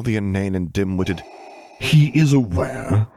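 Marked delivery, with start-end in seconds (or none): 1.8-3.1 slow, loud growled words. Acted. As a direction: growl it out, drawn slowly. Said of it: "he growled loudly".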